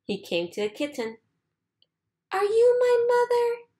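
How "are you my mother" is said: high, small pleading chick voice; a tiny lost bird asking; baby bird voice. A narrator's reading. Baby bird asks the kitten if it's his mother, high and small. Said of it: "he said to the kitten".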